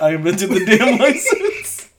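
repeating a joke through laughter; still laughing. Real, not acted. Co-host quoting the senator joke back, still laughing. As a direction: laugh breaking into the words, rising at the end. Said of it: "I'm still laughing".

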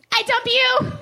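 blurted high and loud, with a laugh; the clumsy real breakup line, laughing; awkward dump. Retold or imitated. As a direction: fumble it out too high. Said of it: "It was so, not even timed well".

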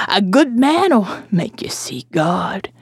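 slow croaking whisper. Acted. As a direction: whisper it like a dirty secret.

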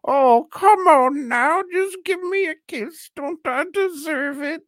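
very high falsetto granny, pleading; mock granny. Movie grandma pressing reluctant girl for a kiss, mock granny. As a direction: wheedle like a hammed-up grandma, loud at first then easing. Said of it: "the grandma".

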